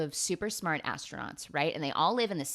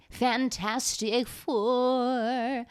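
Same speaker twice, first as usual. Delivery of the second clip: Fantastic Four, stretched very slowly and pitched higher; drawn-out announcer build; 7 semitones higher, much slower than usual. Shown as a demonstration.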